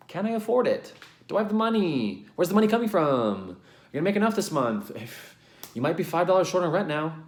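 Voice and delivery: high, rushed panicky questions; anxious inner voice. Retold or imitated. Vlogger voicing her old worried self, high and panicky. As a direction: spiral into money panic.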